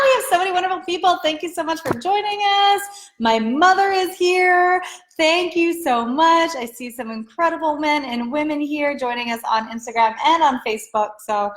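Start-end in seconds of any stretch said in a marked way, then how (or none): 0.0-6.5 excited thanks. Real, not acted. Host thanks people and mother for joining, excited. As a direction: gush with gratitude at a growing crowd. Thanks viewers as they join.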